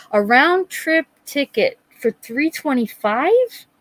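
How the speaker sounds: high, slow disbelief rising at the end; real astonished query; incredulous question. Real, not acted. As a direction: question the price in amazement. Reacting to ticket price.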